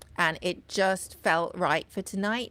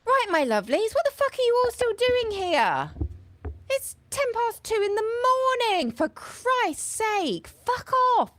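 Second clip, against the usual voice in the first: much higher, shrill-free shrieking landlady voice chasing everyone out; rude closing-time landlady; 6 dB louder than usual. Acted. Landlady throwing the late guests out at two in the morning, high and shouting. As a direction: squawk at the stragglers to leave.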